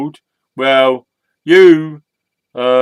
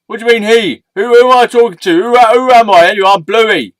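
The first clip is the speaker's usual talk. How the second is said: higher, quick, flustered protest; indignant; 8 semitones higher, 8 dB louder than usual. Acted. Storyteller challenged over he or she, indignant and flustered. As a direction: fire back questions, pitched up and offended.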